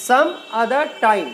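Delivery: some other time spelled out slowly; slow it down so each word is clear. Shown as a demonstration. Teacher to pupils, slow word-by-word model. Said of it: "एक साथ बोलेंगे".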